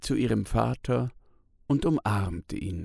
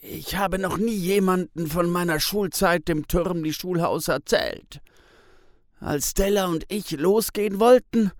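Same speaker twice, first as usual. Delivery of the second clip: higher, pained storytelling man; Tis, sad memories; 7 semitones higher, 4 dB louder than usual. A narrator's reading. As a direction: relive a hard childhood. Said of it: "Tis sagt". (Tis erzählt bedrückt von Schlägen in der Schule)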